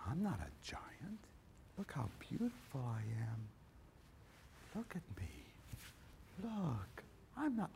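soft, breathy temptress whisper; seductive giant voice. Acted. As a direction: coo it like a seductress. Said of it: "The giant says".

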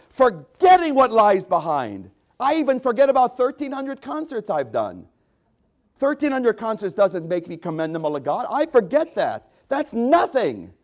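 loud, high-pitched pulpit preaching, slowing on key words; fiery preaching. Acted. Preacher rousing the congregation to forget the past, loud fiery preaching. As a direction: preach it out with full force.

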